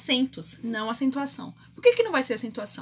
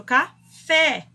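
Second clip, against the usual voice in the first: "café" with a loud, punched final FÉ; stress on FÉ; 7 dB louder than usual. Shown as a demonstration. Teacher showing students the strongest syllable, stressed FÉ. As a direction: hit the second syllable of "café" hard. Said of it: "Qual que é a sílaba mais forte?".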